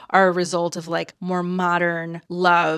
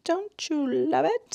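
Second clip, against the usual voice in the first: "Don't you love it?" sung up high, slow, rising at the end; high drawn-out coo; 9 semitones higher, 4 dB quieter than usual. Shown as a demonstration. Gushing to viewers about the show, high slow coo.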